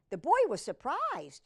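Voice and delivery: plain reading voice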